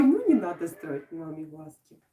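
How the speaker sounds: ordinary talk, nothing marked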